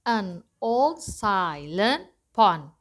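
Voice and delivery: put-on slow, every syllable of "An old silent pond" separated; counting syllables. Shown as a demonstration. Teacher showing students how to count syllables, slow and spaced. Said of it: "cara ngitung syllables-nya".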